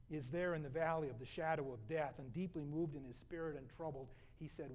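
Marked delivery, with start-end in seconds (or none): none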